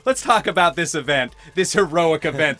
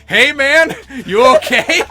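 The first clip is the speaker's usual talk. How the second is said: louder, higher worried check-in; concerned roommate; 7 semitones higher, 9 dB louder than usual. Retold or imitated. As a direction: lean in and ask if he's okay. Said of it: "Steve ... be like".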